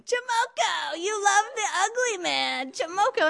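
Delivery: high, sing-song playground taunt, slow; mock a pack of schoolkids jeering; taunting kids. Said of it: "started yelling".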